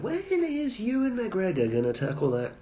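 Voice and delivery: high, slow, laughing mock question; mocking fan voice. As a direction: whine it like an eager fan. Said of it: "walking around going".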